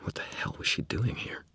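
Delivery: hushed tense whisper; whispered thought. A narrator's reading. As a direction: breathe the question under your breath. Narrator's tense inner thought.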